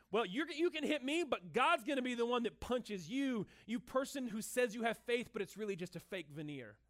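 normal talking voice

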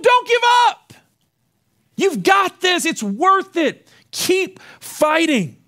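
high, loud exhortation slowing to emphatic beats; impassioned battlefield rallying shout; rallying cry. Acted. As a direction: urge the troops on at full conviction. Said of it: "He tells them".

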